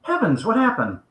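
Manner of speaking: English gentleman. Acted. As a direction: react as a concerned English gentleman. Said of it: "the other guy says".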